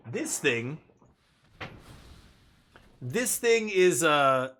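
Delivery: ordinary talk, nothing marked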